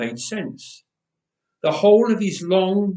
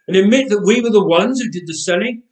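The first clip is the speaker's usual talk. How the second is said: fast, louder scoffing retort; mock the idea, quick and sharp; incredulous; 4 semitones higher, 6 dB louder, faster than usual. A narrator's reading. A brother scoffs at confessing the sale, fast and louder. Brothers arguing over the secret.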